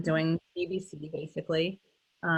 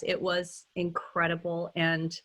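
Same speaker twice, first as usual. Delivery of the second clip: ordinary delivery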